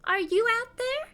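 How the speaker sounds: high little kitty pet voice; high cat voice calling. Acted. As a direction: call out high for a friend. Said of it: "She's like".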